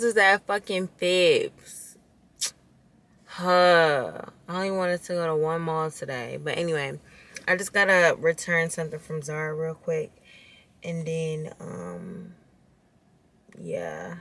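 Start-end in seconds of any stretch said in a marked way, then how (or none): none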